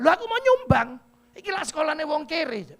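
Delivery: high, loud indignant auntie; a brash auntie insisting. Retold or imitated. Chinese auntie telling the preacher she wants to donate, loud indignant.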